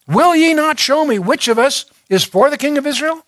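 the king of Syria, troubled, demands to know the traitor among his servants; troubled king's demand. Acted.

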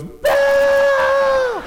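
very high, loud drawn-out shout; little boy shout. Retold or imitated. Son imitated shouting for Belle, very high and loud. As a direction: yell across the parade like a boy. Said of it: "So Jackson goes".

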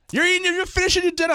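high, strained imitation of a parent's order; scolding father. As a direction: lay down the law as an exasperated dad. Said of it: "my father's like".

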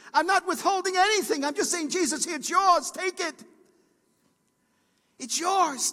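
high, loud cry of surrender; shouted prayer. Acted. Pastor voicing a believer's surrender, crying out loud. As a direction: cry it out to the Lord.

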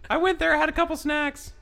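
high falsetto mini review; high-voiced gossip skit. Reviewing the Hondu restaurant to a friend, high comic falsetto. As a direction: keep up the squeaky comic character voice. Continues acted Leia restaurant chat.

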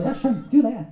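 high, cheeky ventriloquist puppet voice; puppet character. Acted. Puppet correcting its handler, high comic voice.

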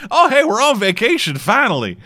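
high, chirpy mock tourist voice; lightly voiced carefree tourist arriving; mock American tourist. A tourist landing in Ireland, high and carefree. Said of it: "planefuls of Americans just fucking flew in".

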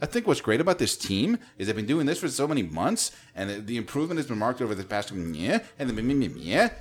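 every phrase ending swings up, down to a mocking "meh"; upward inflection. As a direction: lift the end of each phrase, "team", "months", "meh", like a question. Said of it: "ends with an upward inflection".

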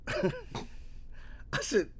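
cracking up while retelling; incredulous laughter. Retold or imitated. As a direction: squeeze the words out through high, breathless laughter. Cracks up telling own disbelief.

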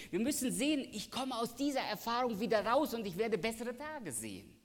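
high, loud preaching voice, driving the promise home; full-force preaching. Acted. Preacher proclaiming God's promise to the church, high and loud. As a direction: preach it out to the congregation.

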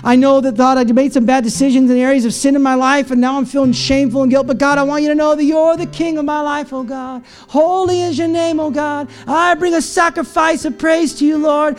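high, impassioned plea building into praise; fervent prayer. Acted. Struggling believer worships God despite hardship, fervent prayer. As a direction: pour it out to God, rising to full fervor.

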